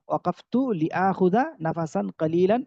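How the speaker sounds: ordinary delivery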